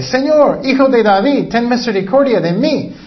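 grito agudo de súplica, más alto y fuerte; clamor suplicante. Acted. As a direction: clama a Jesús desesperada, subiendo la voz. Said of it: "clamaba diciéndole". (clamor desesperado, más agudo y fuerte)

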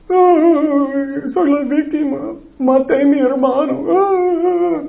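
high, drawn-out fake crying; mock victim wail. Preacher mocking Cain as the victim who killed his brother, mock wail. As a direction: wail it in a hammed-up, self-pitying voice.

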